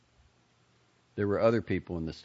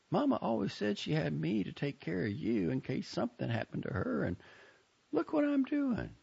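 put-on higher girlish voice; lightly voiced young woman. A narrator's reading. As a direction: voice the sister, curious. Joni voiced high.

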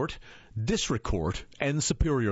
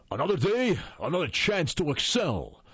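lightly voiced big-personality quote, pitched up; booming voice; 5 semitones higher than usual. A narrator's reading. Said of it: "He had a booming voice".

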